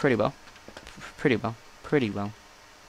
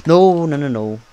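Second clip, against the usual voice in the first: loud, high cry of alarm; alarmed shout; 4 semitones higher, 10 dB louder than usual. Real, not acted. Gamer reacts to a sudden mishap, shouting in alarm. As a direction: yell it out in sudden panic.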